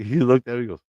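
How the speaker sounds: usual speaking voice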